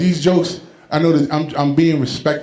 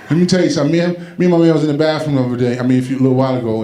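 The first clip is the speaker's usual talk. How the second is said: normal talking voice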